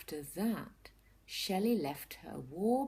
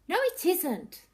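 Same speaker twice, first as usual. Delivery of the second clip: high, loud cross snap; snapped; 12 semitones higher, 9 dB louder than usual. A narrator's reading. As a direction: bite the words back at the bird. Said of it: "snapped Shelley".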